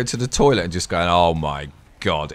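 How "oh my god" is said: low, slow mock strain; straining groan. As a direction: groan it out like pushing hard. Straining on the toilet.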